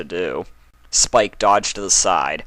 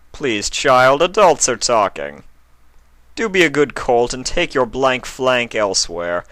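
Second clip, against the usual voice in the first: loud, higher patronizing snob; condescending prince; 6 semitones higher, 4 dB louder than usual. A narrator's reading. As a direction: dismiss the child with cold disdain.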